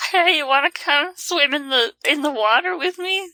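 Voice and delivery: lightly voiced coaxing water horse; luring water horse. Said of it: "That's the Kelpie's voice now".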